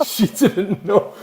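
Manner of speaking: laughing through the words; storyteller laughing at the memory. Real, not acted. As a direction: crack up while telling it. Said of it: "she was so".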